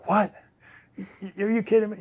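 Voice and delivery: bursting out in shock; retold disbelief. Retold coach told he won't come, shocked and disbelieving. Coach reacts to him backing out.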